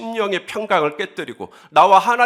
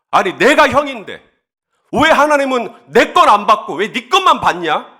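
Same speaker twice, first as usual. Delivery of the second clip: put-on raised, aggrieved older-brother protest; angry Cain; 3 semitones higher, 5 dB louder than usual. Preacher voicing an elder brother's complaint against God, angrily. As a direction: demand answers in indignant, louder bursts. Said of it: "계속 화가 나는 거예요".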